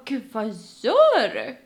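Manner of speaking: loud, slow, stretched-out indignation; shocked protest. Retold or imitated. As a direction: retell a shocked objection, dragging the verb. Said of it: "jag blir lite så här chockad".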